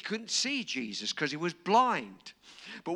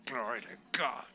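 ordinary talk, nothing marked

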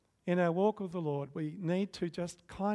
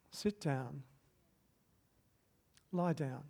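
gentle command; 2 semitones lower, 6 dB quieter, slower than usual. Retold or imitated. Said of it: "He actually says".

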